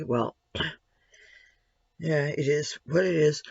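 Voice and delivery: hoarse, gravelly voice; a rough, gravelly throat. Real, not acted. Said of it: "does my voice sound a little gravelly".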